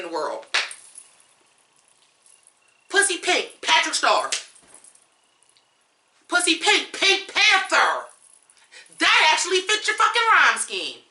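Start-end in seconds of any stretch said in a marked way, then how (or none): 2.9-11.0 high sarcastic alternatives; mocking suggestions. Real, not acted. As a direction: sarcastically offer better rhymes.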